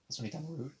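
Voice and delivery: accidental squeaky break, said softly; voice crack. Real, not acted. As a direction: voice cracking upward mid-quote. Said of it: "That was another voice crack".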